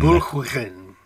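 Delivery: BOURGOIN with throaty ch and "gwin" ending; modelling Welsh pronunciation. Shown as a demonstration. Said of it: "They have here".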